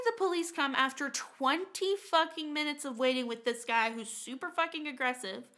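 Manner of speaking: usual speaking voice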